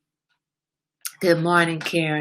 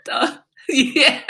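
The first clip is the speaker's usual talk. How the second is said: usual speaking voice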